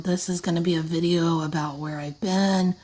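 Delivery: ordinary talk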